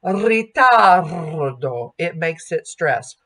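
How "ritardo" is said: ritardo very slow, with a rolled R; trilled R. Shown as a demonstration. Coach demonstrating the rolled Italian R. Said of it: "when we roll that R or trill that R".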